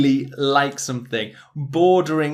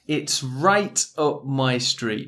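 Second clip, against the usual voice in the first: slow model phrase; slower than usual. Shown as a demonstration.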